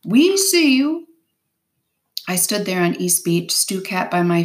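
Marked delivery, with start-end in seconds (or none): 0.0-1.1 high, slow, drawn-out call; a man hailing from afar, louder and higher; distant shout. A narrator's reading. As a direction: shout slowly across the water. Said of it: "There was a shout from across the water".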